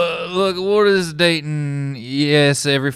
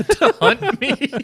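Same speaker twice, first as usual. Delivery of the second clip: high, laughing repeat of the question; laughing disbelief; slower than usual. Acted. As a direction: squeal the question through laughter.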